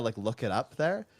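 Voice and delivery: usual speaking voice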